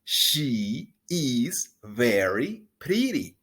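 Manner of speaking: slow, clear pronunciation model; slow model sentence. Shown as a demonstration. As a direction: spell it out slowly for repeating. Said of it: "Una vez más".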